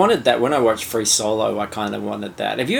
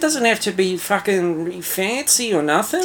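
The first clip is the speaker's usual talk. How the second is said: put-on much higher, fast, whiny Australian accent; pleading Australian Skrull; 11 semitones higher than usual. Cohost voices the Skrull begging a ride home, high Australian whine. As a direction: beg for bus fare like a down-and-out Aussie. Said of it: "a hundred percent Australian accent".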